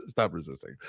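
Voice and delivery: low, soft, laughing stop resisting; mock cop order. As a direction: mutter it like a lazy cop.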